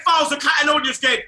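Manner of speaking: loud, high outraged yell; outraged shout. Real, not acted. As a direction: yell it in disbelief. Angry rant about referee.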